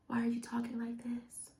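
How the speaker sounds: very soft, held-back mimicry; softened girly voice. Coach mocking girls who talk softly in videos, very hushed. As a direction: barely let the voice out, mocking soft talkers. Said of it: "the girls are talking like this".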